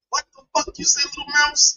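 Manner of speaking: high, thin teasing voice; mocking. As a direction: tease in a high mousy pitch. Mocking the quiet talker as Mouse.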